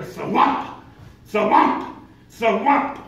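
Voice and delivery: loud mock thumping noise, rising; thwomp sound. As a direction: punch out each thwomp like a flat tire. Imitating the car's flapping sound.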